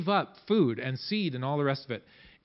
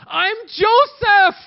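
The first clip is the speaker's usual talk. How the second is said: slow, high and loud cry of the name; emotional reveal; 10 dB louder, much slower than usual. Acted. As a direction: let it burst out of him at last. Joseph reveals himself, weeping.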